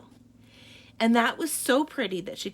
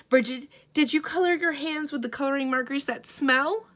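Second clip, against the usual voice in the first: higher, louder anxious questioning; urgent questioning; 6 semitones higher, 6 dB louder than usual. Acted. Mother questioning her daughter, anxious and loud. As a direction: press her for answers.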